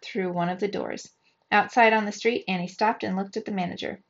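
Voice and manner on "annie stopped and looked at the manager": usual speaking voice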